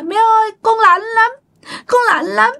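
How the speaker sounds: put-on high-pitched pleading child spirit; possessed child voice. Dead child asking mother for burnt clothes, child voice. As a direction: beg mother like a cold little child. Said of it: "Cái lúc này nó mới nói".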